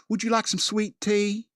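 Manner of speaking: high Southern woman's drawl; Southern belle accent. Acted. Southern woman in a sundress offering tea, Southern accent. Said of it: "beautiful Southern woman in a sundress ask you".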